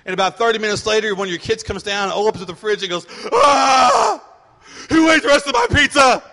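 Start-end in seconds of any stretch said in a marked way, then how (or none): 3.3-4.2 high, loud "Ah!" of outrage; kid's shocked yell. Retold or imitated. Kid at the fridge finding pizza gone, loud high yell. 4.9-6.2 put-on indignant kid. Kid finds pizza gone.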